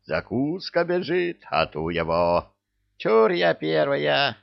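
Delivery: high, eager, greedy squeal; gleeful excitement. A narrator's reading. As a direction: shout it like hungry hunters. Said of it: "Головы радостно загомонили".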